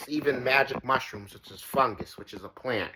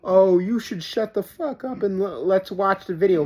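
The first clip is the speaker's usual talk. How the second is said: imitating a heckler; 6 semitones higher than usual. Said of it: "negative feedback from".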